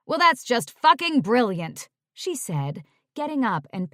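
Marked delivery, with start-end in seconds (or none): 0.1-1.9 loud, biting sarcasm; sarcastic anger. Acted. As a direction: snap it out sarcastically. Angry agent, sarcastic.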